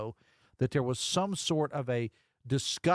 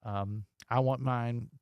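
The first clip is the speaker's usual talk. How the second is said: normal talking voice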